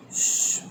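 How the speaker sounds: soft hushing hiss; shushing. Acted. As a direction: give a voiceless, gentle shush. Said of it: "What Ravi said?".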